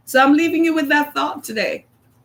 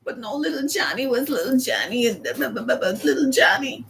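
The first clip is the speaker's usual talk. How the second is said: dismissive mumble; 5 dB quieter than usual. Retold or imitated. Dismissive mocking of excuses.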